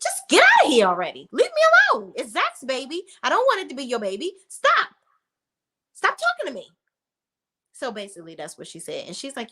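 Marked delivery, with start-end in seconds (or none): 0.0-6.7 mock angry outburst. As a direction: snap at him to get out. Karen snapping at Aaron.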